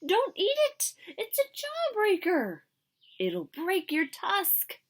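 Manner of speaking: high, loud squeaky mouse warning, slowing at the end; tiny frantic mouse voice. A narrator's reading. Mouse warns Ganesha about the jawbreaker, squeaky. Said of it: "squeaked Mister Mouse".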